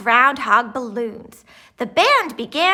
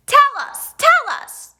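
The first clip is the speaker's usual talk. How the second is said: much higher, louder chanting demand; crowd shout; 7 dB louder than usual. A narrator's reading. Crowd demands the prediction, shouting. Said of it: "shouted the crowd".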